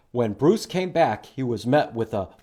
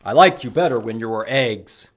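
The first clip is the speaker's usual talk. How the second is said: stern; 4 semitones lower, 7 dB louder than usual. Acted. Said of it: "Bruce was very stern".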